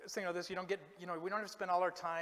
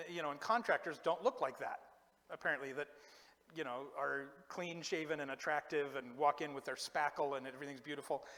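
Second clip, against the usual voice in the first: ordinary talk, nothing marked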